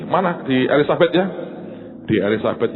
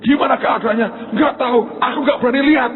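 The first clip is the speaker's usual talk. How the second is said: high, urgent phone voices; mock panic, pitched high; acted panicked exchange; 12 semitones higher, 3 dB louder than usual. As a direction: act out an alarmed question and answer. Retold panicked phone call about the fall.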